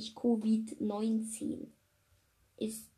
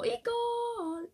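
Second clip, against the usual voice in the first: silly squeak; 12 semitones higher than usual. Real, not acted. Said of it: "Hört euch einfach dieses Egal".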